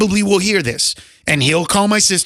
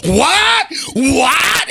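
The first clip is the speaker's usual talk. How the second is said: high-pitched disbelieving yelp; retold shocked outrage; 8 semitones higher than usual. Selena's dad hearing the joke, imitated in high shock. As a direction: imitate an appalled father, pitch jumping up.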